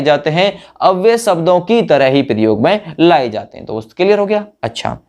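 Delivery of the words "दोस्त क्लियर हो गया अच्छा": usual speaking voice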